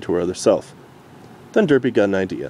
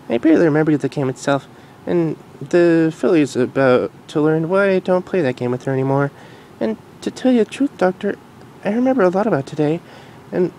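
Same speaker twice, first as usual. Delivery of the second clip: Future Derpy voice; 9 semitones higher than usual. Acted. Future Derpy telling the Doctor, in a high mare voice. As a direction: voice grown-up Derpy gently.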